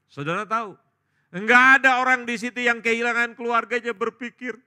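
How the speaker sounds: loud, high emphatic sermon; full-force preaching. Real, not acted. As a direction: hammer the point to the congregation. Preacher driving the point home.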